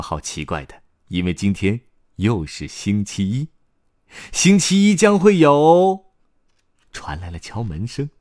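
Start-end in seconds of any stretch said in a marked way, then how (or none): 4.3-6.1 slow, high, loud proclamation; excited announcement. Acted. Announcing the long-awaited Monday gathering, high drawn-out excitement. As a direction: stretch it out like an announcer.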